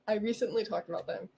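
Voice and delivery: usual speaking voice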